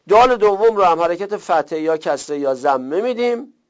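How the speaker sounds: ordinary talk, nothing marked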